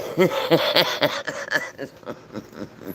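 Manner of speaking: long run of chuckles, fading out; real laugh. Real, not acted. Owner joking about squishing her bird, laughing. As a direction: laugh hard at your own joke. Cracks up at her own joke.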